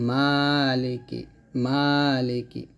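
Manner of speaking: "মালিকি" with a long drawn "maa"; one-beat elongation. Shown as a demonstration. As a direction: stretch the first syllable of "মালিকি". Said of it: "এক লিপ টান দিবেন".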